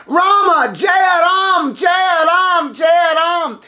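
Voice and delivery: high, loud triumphant shout; monkey god roaring his lord's name; screaming Rama's name. Acted. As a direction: cry out the name in victory. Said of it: "he screams Rama's name".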